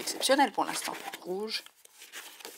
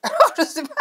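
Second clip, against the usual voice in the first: éclat de rire aigu et fort; 6 dB louder than usual. Real, not acted. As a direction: rire franchement de la blague de maman. Laughs at mother's snow saying. (rit de bon cœur)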